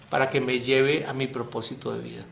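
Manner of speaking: ordinary talk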